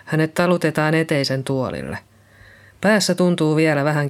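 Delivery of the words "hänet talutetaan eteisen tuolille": ordinary delivery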